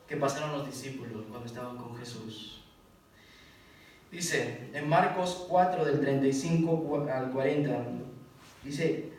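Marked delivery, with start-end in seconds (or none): none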